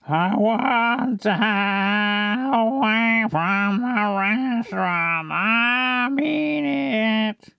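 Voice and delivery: high, very slow, drawn-out voice; Adam's slow talk. Shown as a demonstration. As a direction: drag every vowel out high. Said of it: "I think he talks like that".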